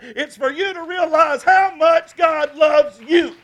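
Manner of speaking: loud, high, slow shout; preached shout. Acted. Pastor shouting the key point to his church. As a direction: hammer each word home. Preacher repeats the climax line.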